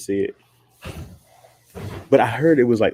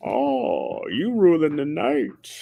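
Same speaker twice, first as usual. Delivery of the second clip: put-on high, slow, drawn-out Bane accent; mock imitation of Bane's accent; 10 semitones higher, much slower than usual. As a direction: ham up Bane's strange accent. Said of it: "What fucking accent is this?".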